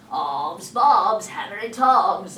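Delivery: loud spooky pirate voice; fake Hook. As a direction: boom like a ghostly Hook.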